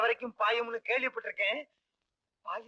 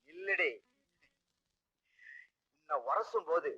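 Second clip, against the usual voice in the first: soft flirting; 6 semitones lower, 6 dB quieter than usual. Acted. Flirting man softens to her.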